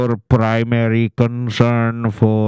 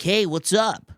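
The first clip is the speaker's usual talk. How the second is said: high bright hey what's up; peppy American greeting; 9 semitones higher than usual. Retold or imitated. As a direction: act the eager American-sounding Indian guy. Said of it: "Or they're like".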